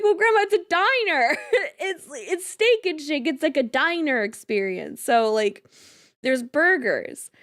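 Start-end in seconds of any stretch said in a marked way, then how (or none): 0.0-3.3 words breaking up with laughter; can't hold the laugh in while explaining; giggling through the line. Real, not acted. Cracking up retelling grandma.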